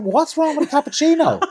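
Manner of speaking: high laughing indignation; high, loud laughing protest. Retold or imitated. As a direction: squeak it out through laughter. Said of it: "I was like".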